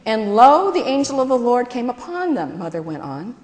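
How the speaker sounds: mother reading the nativity, solemn; scripture reading. A narrator's reading. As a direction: read it grandly like the Gospel.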